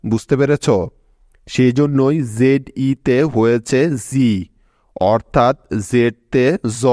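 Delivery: ordinary talk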